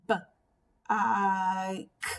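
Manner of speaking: "B, I, K" as separate sounds, b, long i, k; "B, I, K" tapped out phonetically, the "I" held; sounded out. Shown as a demonstration. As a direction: break "B, I, K" apart with a pause after each sound. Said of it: "you can tap out the word".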